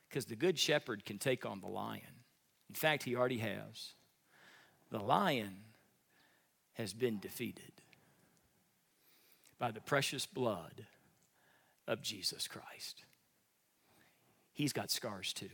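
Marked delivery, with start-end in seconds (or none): 5.0-15.5 soft, slow, near whispered; hushed reverence. Acted. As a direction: drop to a reverent hush. Hushed closing.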